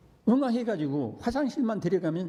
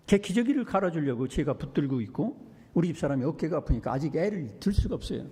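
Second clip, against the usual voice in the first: ordinary talk, nothing marked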